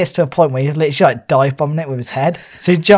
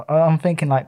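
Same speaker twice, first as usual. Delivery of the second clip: ordinary talk, nothing marked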